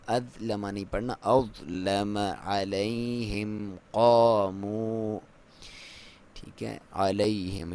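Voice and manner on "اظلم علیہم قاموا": slow drawn-out tajweed chant; Quran recitation. Shown as a demonstration. Teacher recites the verse for students, slow chant. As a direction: recite it slowly with full tajweed. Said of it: "ظا کو موٹا".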